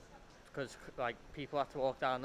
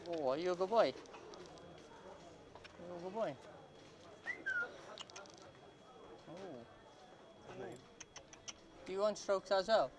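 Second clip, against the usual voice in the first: talking to a dog; 2 semitones higher than usual. Real, not acted. Baby talk to a dog.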